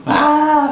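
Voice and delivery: loud, high, sustained shriek; mock terrified scream. Girls hit the floor as window breaks, mock scream. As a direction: let out an exaggerated scream of terror. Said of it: "Scared and running".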